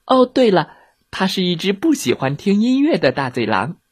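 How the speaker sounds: put-on high, chirpy child's voice; cheeky little girl. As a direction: explain as a little girl would.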